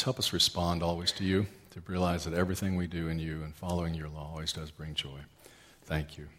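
low, soft, earnest prayer voice; pastoral prayer. Acted. Pastor praying aloud for the congregation, hushed and earnest.

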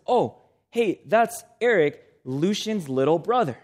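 usual speaking voice

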